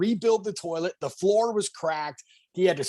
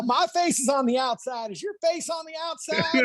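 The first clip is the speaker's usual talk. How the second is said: high, indignant mock voice; proud owner defending his bus; Ralph boasting; 9 semitones higher than usual. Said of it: "he's all".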